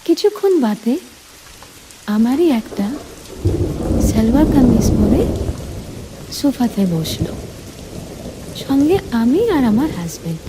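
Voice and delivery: plain reading voice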